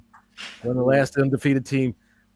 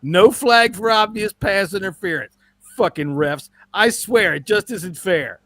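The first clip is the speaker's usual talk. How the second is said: higher, complaining sore fan; whiny fan voice; 6 semitones higher than usual. Pretend. Host reads an Eagles fan's letter in a whiny voice. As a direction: whine about the refs like a sulking fan.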